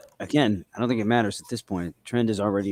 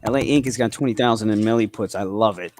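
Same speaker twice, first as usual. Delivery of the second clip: ordinary talk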